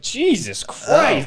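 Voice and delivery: slow, high mock disbelief; appalled. Passenger reacting to crude driver, mock appalled. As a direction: stretch it out, appalled, as a retold reaction.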